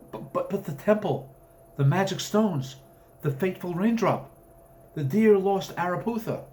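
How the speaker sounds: cling desperately to the tale; distressed pleading. Acted. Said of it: "The colonel seemed most distressed by this".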